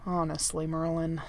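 low, weary exasperation; exasperated. A narrator's reading. Prince fed up with his manservant, exasperated. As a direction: sigh it out, patience gone. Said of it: "the prince sounded exasperated".

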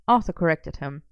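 usual speaking voice

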